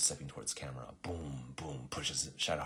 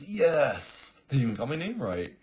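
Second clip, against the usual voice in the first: loud, much higher delighted gloat; gleeful villain; 9 semitones higher, 9 dB louder than usual. Acted. Gothar gloats after the king's death, loud and gleeful. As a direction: crow with joy over the kill. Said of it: "And then Gothar goes".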